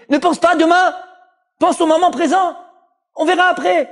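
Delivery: high mocking devil on the shoulder; tempting Yetzer Hara. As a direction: lure them in, giggling and wheedling. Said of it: "c'est Yetzer Hara".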